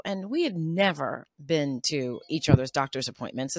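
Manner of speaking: ordinary talk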